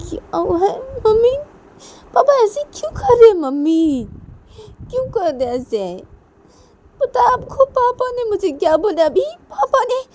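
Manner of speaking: high, plaintive daughter voice; upset, whining complaint. Acted. Daughter complaining to mummy about Papa, upset whine.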